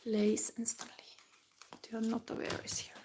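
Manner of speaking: soft whisper while laying out cards; whispering to herself. Real, not acted. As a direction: breathe the words to yourself, unvoiced. Reader murmuring to herself over cards.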